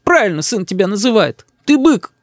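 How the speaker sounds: very high, hurt tirade; high-pitched aggrieved outburst; outraged mother. A narrator's reading. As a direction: denounce your husband as a brute.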